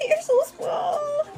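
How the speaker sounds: put-on very high, slow baby-adoring squeak; tearful cooing. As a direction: melt over an imagined tiny newborn. Imagines cooing at tiny newborns.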